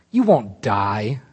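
slow hissed serpent line, understated. A narrator's reading. Serpent reassuring the woman, a slow understated hiss. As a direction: let it slide out slowly. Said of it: "the serpent hissed".

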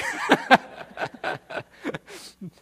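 long breathless laughter trailing off; real laugh. Real, not acted. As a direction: let the laugh run out. Keeps laughing after quote.

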